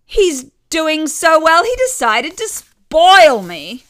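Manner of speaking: a mother showing off, glowing with pride; beaming mother. A narrator's reading.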